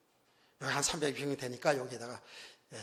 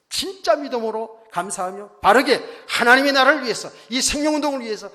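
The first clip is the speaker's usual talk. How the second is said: loud, raised exhortation; preaching at full force; 7 semitones higher, 13 dB louder than usual. Real, not acted. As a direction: drive each phrase home from the pulpit. Preacher drives exhortation home.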